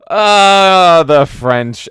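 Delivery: loud, drawn-out opening sigh into speech; Orson Welles. Acted. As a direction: intone it grandly, starting high and loud. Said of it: "still thinking of Orson Welles".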